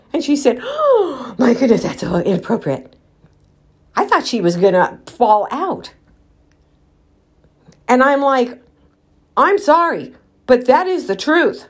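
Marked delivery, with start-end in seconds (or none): 0.6-2.9 shocked gasp. Retold or imitated. Manager to the customer, imitated as scandalised and shocked. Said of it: "her eyes rolled wide open". 9.4-11.7 defiant retort. Retold or imitated. Defiant reply to manager.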